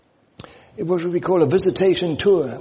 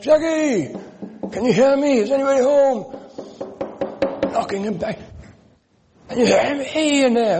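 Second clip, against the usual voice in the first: calling out; 8 semitones higher than usual. Retold or imitated. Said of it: "calling out".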